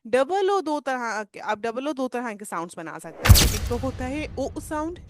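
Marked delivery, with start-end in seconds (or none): 4.4-4.6 ओ as a brief 'u' in cook; short OO. Shown as a demonstration. Teacher contrasting double-O sounds, short ओ. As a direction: keep ओ short and quick. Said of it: "short सा ओ sound".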